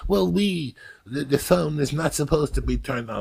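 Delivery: mock his hesitant, proper-sounding protest.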